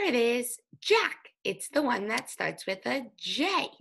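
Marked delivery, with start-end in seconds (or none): 0.8-1.3 high bright reveal of "Jack"; cheerful teacher reveal; excited reveal. Shown as a demonstration. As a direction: announce "Jack" like a happy discovery.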